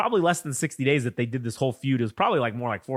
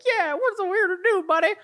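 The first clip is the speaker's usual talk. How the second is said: high, put-on sneering sidekick voice; squeaky mock echo of the boss; mocking crony voice. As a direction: play a smarmy yes-man, pitched way up. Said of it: "And they're like".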